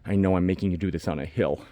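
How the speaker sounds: normal talking voice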